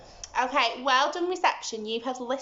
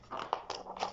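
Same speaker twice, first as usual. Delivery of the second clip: hushed gasp; 14 dB quieter than usual. Pretend. Teacher acts fright.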